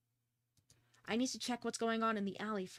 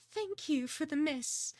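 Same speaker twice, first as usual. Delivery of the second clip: affected dame; 4 semitones higher, slower than usual. Acted. Voices the grieving dame.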